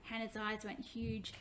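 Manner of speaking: ordinary delivery, nothing marked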